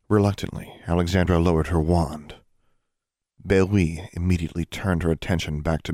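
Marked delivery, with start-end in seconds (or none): none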